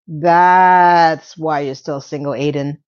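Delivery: low, drawn-out sarcastic jab; slow mocking retort. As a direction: spell it out slowly, mocking.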